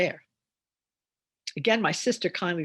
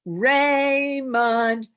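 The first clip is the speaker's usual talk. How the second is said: loud, high call across the yard; mother calling child; 7 semitones higher, 8 dB louder than usual. Shown as a demonstration. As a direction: call your child home for dinner. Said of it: "the mother's calling her child".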